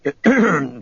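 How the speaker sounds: pointed, high little throat-clear. A narrator's reading. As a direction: clear your throat before announcing. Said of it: "Nereus cleared his throat".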